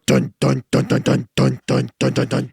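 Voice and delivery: chug out a one-note riff; imitated riff. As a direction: percussive mouthed guitar chugs on one note.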